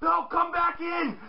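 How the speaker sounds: high pleading yells; mock shout. Teammates yelling at Kofi to come back. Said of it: "They're like".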